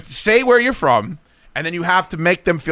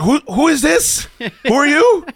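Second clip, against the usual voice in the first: confused guest; 9 semitones higher than usual. Retold or imitated. Host mimics guest baffled by the caller, puzzled higher voice. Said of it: "Salisbury's like".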